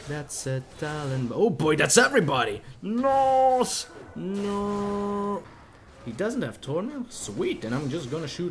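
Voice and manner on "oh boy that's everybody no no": loud panicked yelling; panic, cry out loud; panicking gamer. Real, not acted. Whole enemy team appears.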